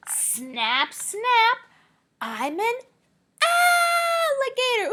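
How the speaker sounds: loud snapping, rising to a high shout; playful alligator. Pretend. Teacher plays the alligator for the class. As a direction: snap and roar it out.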